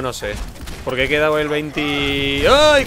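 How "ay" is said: sudden high loud yelp; startled yelp. Real, not acted. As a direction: jump at a near miss. Nearly caught in the game.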